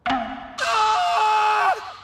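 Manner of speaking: high, loud startled yell; shocked cry. Acted. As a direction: cry out in sudden dismay. Realizes wrong school.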